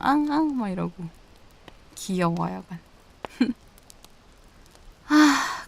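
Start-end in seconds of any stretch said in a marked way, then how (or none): 0.1-0.6 high-pitched cutesy yapping; puppy imitation. Teasing a bashful partner, imitated as a high puppy. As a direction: yap like a pleased puppy, teasingly. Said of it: "강아지 마냥 막".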